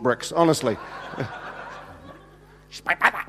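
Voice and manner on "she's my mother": hushed mock stage whisper. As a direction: repeat it as a hammed-up whisper. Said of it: "in a stage sort of a kind of a".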